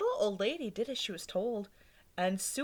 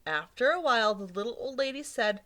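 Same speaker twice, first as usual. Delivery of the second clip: ordinary delivery, nothing marked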